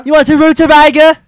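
very high, loud mock British; British accent. Friend mocking the word rutabaga, hammed-up high British accent. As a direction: squawk it in a put-on British accent.

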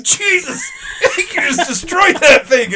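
high-pitched, amazed outburst with laughter; retold shocked exclamation. Storyteller playing friend reacting to the destroyed toilet, shocked outburst.

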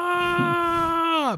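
high wailing cry of pain; power up scream. Retold or imitated. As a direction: howl as the stone hits your foot. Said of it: "las lágrimas se cayeron de mis ojos".

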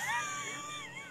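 high wheezing snicker; wheezy Muttley laugh. Real, not acted. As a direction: snicker like the cartoon dog Muttley. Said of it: "Kind of laughing like Muttley".

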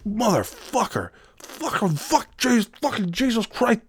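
mock a high, squealing coworker losing it; high whiny meltdown. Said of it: "He's like".

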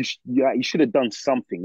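normal talking voice

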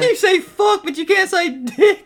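high giggling jab; laughing tease. Real, not acted. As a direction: laugh while mocking him. Teasing through laughter.

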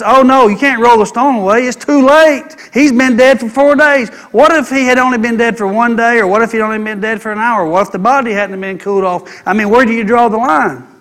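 high, loud protesting objector; unrighteous consciousness. Acted. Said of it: "The unrighteous consciousness".

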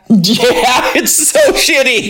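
high voice through giggles; laughing. Real, not acted. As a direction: laugh while talking. Laughing at the bad apology.

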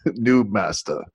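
Korg accent impression; name the culprit in a Korg accent. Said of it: "Don't do the accent".

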